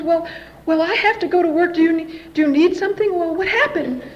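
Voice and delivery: hesitant, high mock concern; worried mother. Mother who must leave for work, high worried voice. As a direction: imitate a fretting mother, high-pitched.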